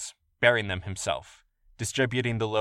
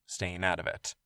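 plain reading voice

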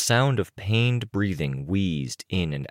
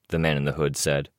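usual speaking voice

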